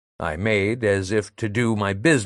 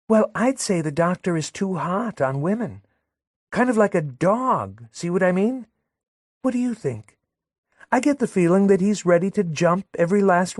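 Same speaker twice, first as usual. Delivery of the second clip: put-on much higher, prodding young woman, quickening; Madelon, needling; 10 semitones higher than usual. Madelon prodding Léon to agree about the doctor, high insistent voice. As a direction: push him to agree, rising insistence.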